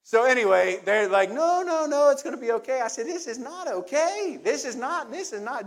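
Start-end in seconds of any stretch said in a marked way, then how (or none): 1.3-2.8 coaxing friends. Retold or imitated. 3.1-5.7 high, slow, insistent this is not okay; firm refusal. Retold or imitated. To the coaxing women, a high, slow, firm refusal.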